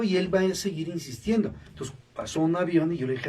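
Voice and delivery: normal talking voice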